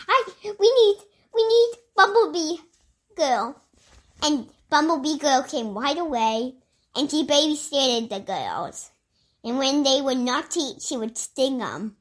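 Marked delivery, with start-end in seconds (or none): none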